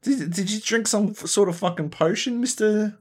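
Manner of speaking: high-pitched incredulous questioning; mock bewilderment. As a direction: squeak it out, baffled by the sudden change. Voices his bewildered reaction to switch.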